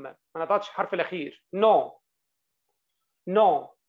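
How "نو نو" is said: نو said louder, lips closing silently on the m; lips close, no m. Shown as a demonstration. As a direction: model نو for the class, lips closed, no m. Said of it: "انطق الأم بس". (نو بضم الشفايف بدون ميم)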